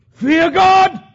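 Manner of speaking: high, loud exhortation; preaching cry. Acted. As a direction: proclaim it to the whole room. Preacher repeats the thief's cry.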